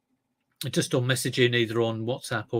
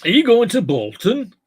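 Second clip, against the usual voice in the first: exaggerated northern English accent, high and loud; hammed-up regional accent, pitched up; terrible local accent; 9 semitones higher, 10 dB louder than usual. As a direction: put on a bad Bolton accent. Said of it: "terrible accent".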